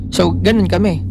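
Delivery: ordinary talk, nothing marked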